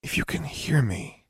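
hushed, tentative plea in a whisper. A narrator's reading. Girl pleading with a possible ghost to answer, whispered. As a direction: whisper into the empty corridor. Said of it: "opened her mouth and whispered".

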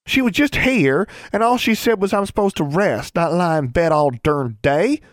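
put-on high, loud girlish protest; indignant young girl. Younger sister struggling to get up, protesting. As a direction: argue back in a high child's voice.

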